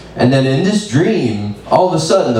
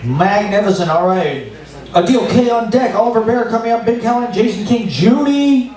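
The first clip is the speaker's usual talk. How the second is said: high, hyped open-mic host calling names; mc announcer; 11 semitones higher than usual. Acted. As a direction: pump up the room as the emcee. Host announces next comics.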